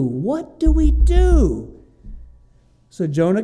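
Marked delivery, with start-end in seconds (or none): 0.0-1.7 high, loud, slow desperate cry; desperate sailors. Acted. Preacher voicing sailors in the storm, slow loud desperate cry. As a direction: stretch it out in helpless panic.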